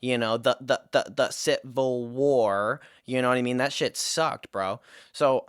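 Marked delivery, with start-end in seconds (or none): 0.4-2.8 stuttering, stumbling read-aloud; imitated stutter. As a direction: trip over the first word, then drag the rest. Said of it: "I'm fucking stuttering".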